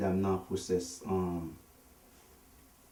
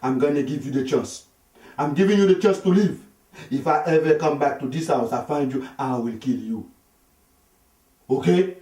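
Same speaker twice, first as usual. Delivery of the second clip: higher, louder menacing warning; threatening shout; 6 semitones higher, 7 dB louder than usual. Retold or imitated. Husband warning the young man never to come back, loud and high. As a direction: threaten him out of the house. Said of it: "He said".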